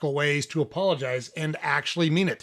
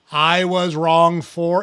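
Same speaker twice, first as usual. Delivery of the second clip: very slow, louder, every word weighted; slow emphatic delivery; 7 dB louder, much slower than usual. Shown as a demonstration. Coach drilling the key apology phrase, slow and loud. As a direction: spell it out slowly for them. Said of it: "remember this sentence".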